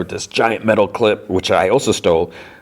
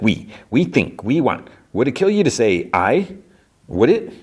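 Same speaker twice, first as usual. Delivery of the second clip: exasperated, pitch climbing higher through the last question; 6 semitones higher than usual. Retold or imitated. Carol mocking Zosia's "we", rising exasperation. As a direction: needle her, getting higher and more fed up with each question.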